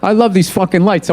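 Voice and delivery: ordinary delivery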